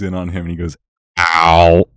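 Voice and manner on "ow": a loud, changed-voice repeat of the ow; dramatic ow. Retold or imitated. Camera zooms in, she repeats Ben's ow loudly. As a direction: replay the ow as a zoomed-in close-up.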